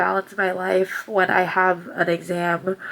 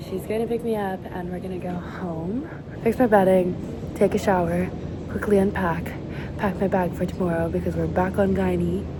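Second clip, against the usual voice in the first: ordinary delivery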